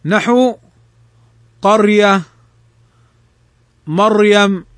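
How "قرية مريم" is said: قرية and مريم with a thick, closed r; heavy ra. Shown as a demonstration. Teacher models قرية and مريم, heavy still ra. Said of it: "أو مضموما فخمت".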